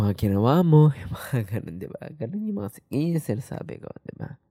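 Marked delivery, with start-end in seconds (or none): none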